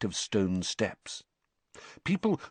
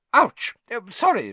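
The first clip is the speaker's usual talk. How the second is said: high, loud ouch into a hasty apology; yelp, then apologise at a high pitch; startled yelp; 13 semitones higher, 7 dB louder than usual. A narrator's reading. Teacher skids into a pupil and apologises, startled yelp.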